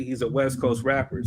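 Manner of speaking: usual speaking voice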